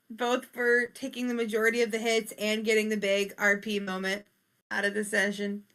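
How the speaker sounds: ordinary talk, nothing marked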